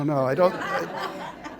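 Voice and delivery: usual speaking voice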